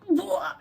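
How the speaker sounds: loud, high retching sound; mock retching blah. Throwing up the toxic water, a loud mock retch. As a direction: fake a quick retch.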